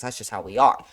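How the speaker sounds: usual speaking voice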